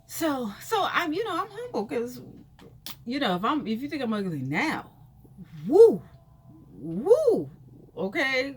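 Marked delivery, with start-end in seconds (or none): 5.7-8.5 loud, high "woo"; loud whoop. Real, not acted. Joking to viewers about her bare face, loud whoop. Joking about how she looks without makeup.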